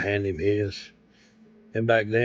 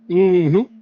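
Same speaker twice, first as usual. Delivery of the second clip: smug mm-hmm; 9 semitones higher, 11 dB louder than usual. Retold or imitated. Roy's smug reply to trooper.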